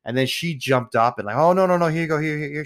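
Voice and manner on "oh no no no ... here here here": imitating a panicked friend, pitched up; high, hurried placating voice; flustered. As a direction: rattle it out in a mock fluster. Friend jumps up, hurriedly pays.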